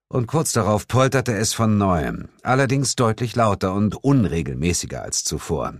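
ordinary delivery

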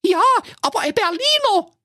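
put-on very high, childish chorus voice; piping comic children. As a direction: kids chiming in together, squeaky and eager. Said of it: "riefen Klappstuhl und Rosenrot wie aus einem Munde".

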